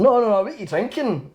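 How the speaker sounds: high-pitched, mock-insistent manager; retold insistence, jumping high in pitch; imitating insistent manager. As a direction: wave away the answer and press the question, voice high. Manager rejects water, retold.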